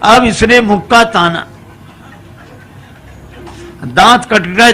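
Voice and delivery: ordinary talk, nothing marked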